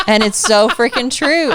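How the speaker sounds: high laugh spilling into "so freaking true". Real, not acted. Wife laughing at a listener's jab at husbands. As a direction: laugh through the line.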